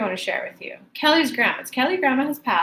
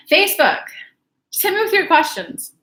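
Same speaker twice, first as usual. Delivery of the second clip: high, louder call to the audience; upbeat host call-out; 6 semitones higher, 4 dB louder than usual. Real, not acted. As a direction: summon the Facebook viewers. Calling out to viewers.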